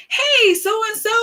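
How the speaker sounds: high, drawn-out hello; cheerful greeting. Acted. Acts out greeting in a message.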